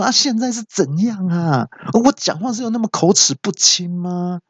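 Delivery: high, mock-indignant outburst; exasperated cry. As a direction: demand what is going on. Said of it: "我就气到大叫说".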